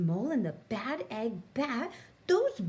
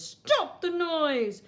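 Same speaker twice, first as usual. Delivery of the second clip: high, loud slow bat screech; 7 semitones higher, 5 dB louder, slower than usual. A narrator's reading. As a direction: scream for the noise to stop. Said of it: "he screeches".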